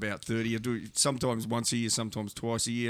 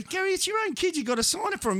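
incredulous plea. Retold or imitated. Agent pleads again.

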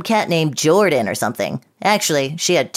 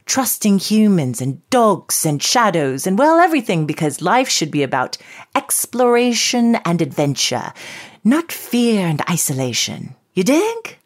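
put-on English accent. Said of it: "I'm an English short-haired pointer".

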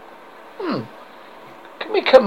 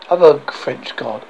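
usual speaking voice

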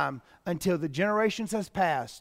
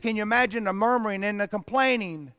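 ordinary talk, nothing marked

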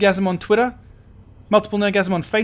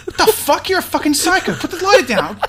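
high-pitched frantic outburst; alarmed yelling; horrified panic; 9 semitones higher, 4 dB louder than usual. Acted. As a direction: freak out in acted horror. Guest sets himself alight.

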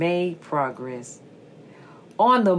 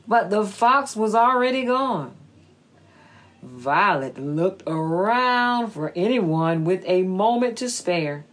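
usual speaking voice

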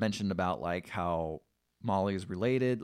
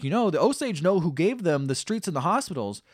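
louder, higher warning; stern threat; 7 semitones higher, 7 dB louder than usual. Retold or imitated. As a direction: press the threat home. King threatening nephew.

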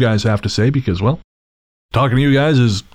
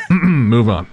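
laughing. Real, not acted. Laughing, clears throat.

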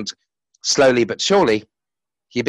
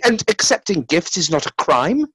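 defiant; 10 semitones higher, 3 dB louder than usual. A narrator's reading. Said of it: "cried Lucifer defiantly".

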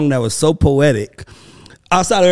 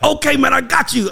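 high-pitched, eager reassurance; excited uncle, imitated; 11 semitones higher than usual. Host retelling his uncle agreeing to help, excited imitation. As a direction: act out an uncle bursting to help. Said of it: "He was just so excited".

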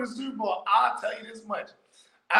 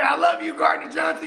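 adoring fan; 8 semitones higher, 4 dB louder than usual. Retold or imitated. Voices the fan.

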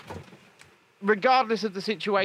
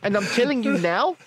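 emphatic mock South African; South African accent; 3 semitones higher than usual. Host protesting about Omicron's origin, emphatic South African accent. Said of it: "the South African accent is the one".

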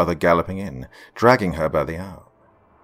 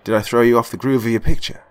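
fast, loud and eager; bouncing with excitement; rushed excited question; 3 semitones higher, 7 dB louder, faster than usual. Acted. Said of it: "Brandon excitedly asked".

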